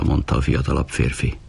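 usual speaking voice

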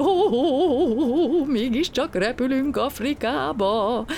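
high, wavering, laughing whoop, drawn out; jubilant. A narrator's reading. Passenger believes he is flying to Africa, jubilant. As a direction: crow with joy on long vowels. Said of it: "újjongott Gombóc Artúr".

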